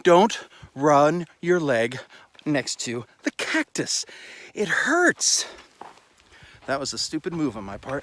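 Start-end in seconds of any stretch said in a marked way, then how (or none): 0.0-4.0 slow. Real, not acted. Mock lesson after cactus. 4.5-5.5 high whine. Real, not acted. Leg brushes the cactus.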